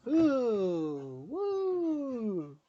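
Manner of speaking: put-on high silly whooping kid; imitating son. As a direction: mimic a wild little boy hooting. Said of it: "and this is him".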